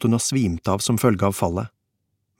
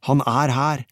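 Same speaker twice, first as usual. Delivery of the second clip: higher voice, mildly calling out; shouted back; 4 semitones higher, slower than usual. A narrator's reading. Peter answering Kåre while running off, calling back. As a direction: call it back with some urgency. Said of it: "ropte jeg tilbake".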